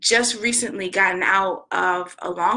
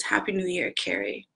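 quiet sad goodbye; 6 dB quieter than usual. Retold or imitated. Miranda soft sad reply.

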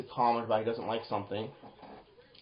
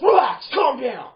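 loud, high, barked command; harsh, drawn-out shout; shouted order; 12 semitones higher, 10 dB louder, slower than usual. Shown as a demonstration. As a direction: yell it like an order. Contrasts harsh order with gentle one.

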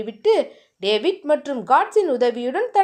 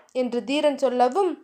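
usual speaking voice

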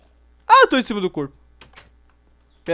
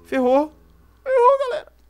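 panicked; 8 dB louder than usual. Real, not acted. Zombies chasing him.

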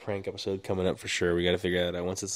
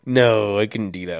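ordinary talk

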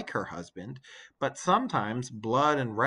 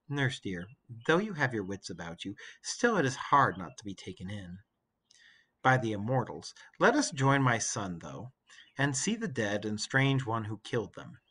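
Penelope, weary. Acted. Penelope answers.

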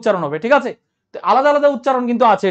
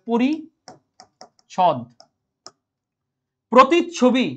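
very slow, drawn-out teaching line; slow dictation; much slower than usual. Shown as a demonstration. Teacher to students, spelling out পরিচ্ছদ very slowly. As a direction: stretch each word for the class. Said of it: "teacher dictating pronunciation".

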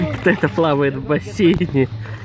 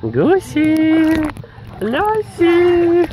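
calling out; 12 semitones higher, much slower than usual. Real, not acted. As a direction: call the geese over, high and bright. Calling the geese. (громко зовёт гусей, высоким голосом)

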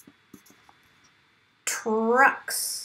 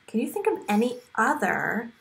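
usual speaking voice

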